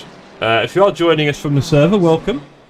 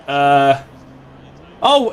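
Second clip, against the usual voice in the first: ordinary delivery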